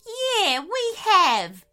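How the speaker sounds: high, loud, insistent voice; bluffing wombat. A narrator's reading. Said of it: "continued the wombat".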